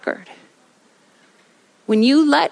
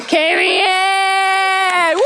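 high, drawn-out name shout; stretched announcer-like holler with a whoop; celebratory cheer; 7 semitones higher, much slower than usual. Real, not acted. Cheering the race winner.

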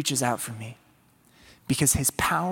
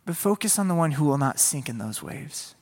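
normal talking voice

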